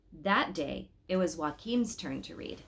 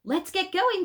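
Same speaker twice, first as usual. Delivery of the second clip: Papa calls out much higher and louder; eager Papa; 13 semitones higher, 6 dB louder than usual. Acted. Papa hurrying Mama and Joachim out for the day's adventure. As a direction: rouse the household, full of energy. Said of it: "said Papa".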